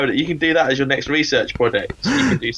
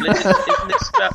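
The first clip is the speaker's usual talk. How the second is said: ordinary talk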